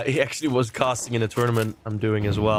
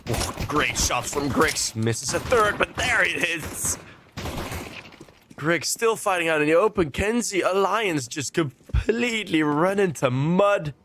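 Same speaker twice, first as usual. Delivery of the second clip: rising, quicker caster call over the action; live play-by-play; 4 semitones higher than usual. Acted. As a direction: call the round as it happens, building up.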